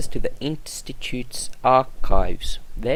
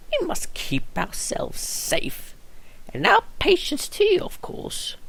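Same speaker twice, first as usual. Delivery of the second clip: imitating the nurse's voice. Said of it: "An imitation of the voice".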